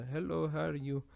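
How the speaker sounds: a hint of an Indian shopkeeper's greeting; mild Indian accent; light Indian accent. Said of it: "the little Indian guy".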